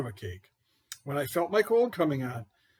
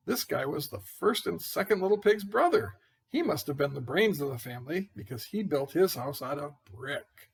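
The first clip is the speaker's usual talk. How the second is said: plain reading voice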